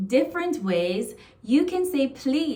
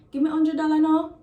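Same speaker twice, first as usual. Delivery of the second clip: higher, louder, fast Jamaican patois; 7 semitones higher, 6 dB louder, faster than usual. Shown as a demonstration. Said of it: "So it's like".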